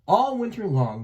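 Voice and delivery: ordinary delivery, nothing marked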